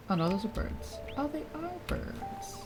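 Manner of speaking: ordinary talk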